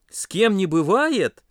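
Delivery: slow, loud, high echo of the excuse; indignant retort. A narrator's reading. Friends press Pasha over his mistake, indignantly. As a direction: throw the words back accusingly, drawn out. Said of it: "наседали на него ребята".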